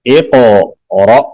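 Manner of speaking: slow "اقرأ" with bouncing q and abrupt final hamza; qalqalah and jolt. Shown as a demonstration. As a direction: bounce the q, cut "اقرأ" short at the end. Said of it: "قاف پہ قلقلہ کریں گے".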